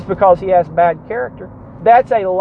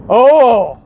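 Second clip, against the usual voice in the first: mocking outrage; 2 semitones higher, 4 dB louder than usual. Mocking charismatic objection.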